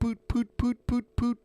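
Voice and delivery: high squeaky pooting noises; fart noises. Pretend. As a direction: imitate farts up high.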